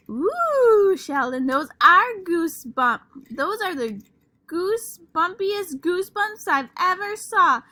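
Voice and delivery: high, loud, delighted; delighted gushing. Acted. Lucille gushes to Sheldon about his goosebumps, high and loud. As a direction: coo over Sheldon's goosebumps.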